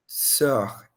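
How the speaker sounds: sœur said as an o blended with e, rounded lips; French œ vowel. Shown as a demonstration. Teacher models sœur's rounded vowel for students. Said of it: "Meio que um O com um E".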